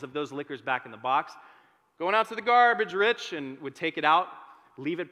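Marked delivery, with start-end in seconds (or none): 2.0-3.3 higher, louder call across the store. Retold or imitated. Calling to boss Rich on the way to the garbage, loud call. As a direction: sing out casually to the boss.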